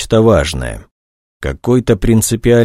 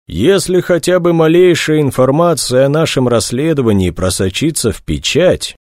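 plain reading voice

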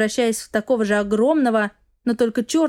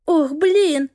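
alarmed; 5 semitones higher, 3 dB louder than usual. A narrator's reading. Sudden alarm.

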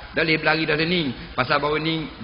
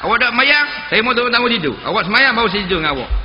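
mock scolding wife, pitched up; nagging wife; 5 semitones higher, faster than usual. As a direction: play the wife laying down her terms. Wife scolding husband, voiced quote.

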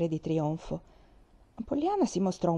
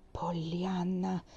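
sussurro duro e secco; la zia rimprovera trattenendo la gioia; dura; 6 dB quieter, much slower than usual. A narrator's reading. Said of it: "La voce della signorina Polly era molto dura". (Pollyanna! sussurrato, aspro)

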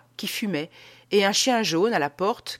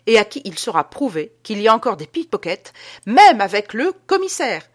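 clamer haut et fort, en crescendo; défi exalté; 4 semitones higher, 8 dB louder than usual. Acted. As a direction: finir sur un éclat emphatique. Adolphe triomphe au sujet des pickpockets. (affirmation triomphante et sonore)

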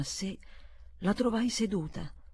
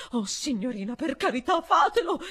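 much higher and loud, pleading cry; exclaiming; 7 semitones higher, 7 dB louder than usual. A narrator's reading. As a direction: beg her to do it. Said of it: "esclamò la mia amica".

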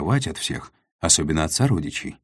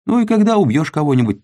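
excited; 12 semitones higher, 8 dB louder, faster than usual. A narrator's reading. Character explaining, pitch jump.

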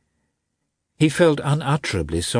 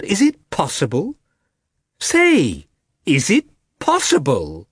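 higher, louder, pressing the question; wife raising her voice at her husband; 7 semitones higher, 4 dB louder than usual. A narrator's reading. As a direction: voice climbs as she demands an answer. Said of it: "raising her voice".

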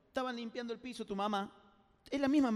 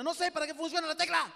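defensive outburst; 5 semitones higher, 8 dB louder than usual. Retold or imitated. Defensive answer to the class.

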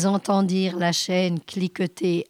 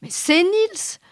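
high-pitched, mildly irritated protest; slightly annoyed; 11 semitones higher than usual. A narrator's reading. Alvor contradicts the captain, a touch annoyed. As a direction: push back with a touch of irritation. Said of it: "un tantinet agacé".

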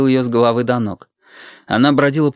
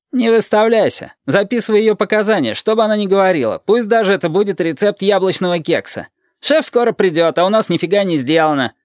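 higher-pitched impatient colleague; acted nagging cop in a raised voice; grumbling policeman; 7 semitones higher than usual. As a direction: order him back to work, pitched up. Colleague scolds the joking blond cop.

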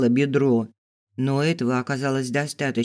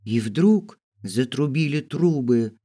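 plain reading voice

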